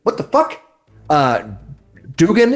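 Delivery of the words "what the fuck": high shocked cry. Retold or imitated. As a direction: blurt it out in shock. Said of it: "he's like".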